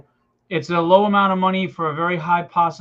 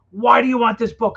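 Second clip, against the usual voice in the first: fiery pitch; 5 semitones higher, 4 dB louder than usual. Shown as a demonstration. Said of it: "You gotta give us that, that fire".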